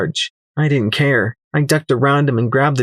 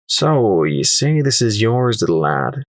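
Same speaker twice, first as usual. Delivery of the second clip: ordinary delivery, nothing marked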